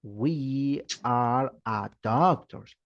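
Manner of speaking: slow, deliberately wrong sentence; teacher models the error slowly; slow wrong example. Shown as a demonstration. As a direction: say the mistake slowly. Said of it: "usted no va a decir".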